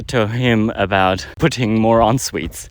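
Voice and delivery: normal talking voice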